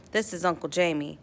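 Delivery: usual speaking voice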